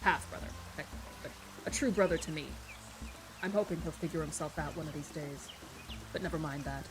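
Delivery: low, soft male character voice; deep-voiced man. A narrator's reading. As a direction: drop low and quiet for his reply. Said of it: "as pleasant as his spoken one".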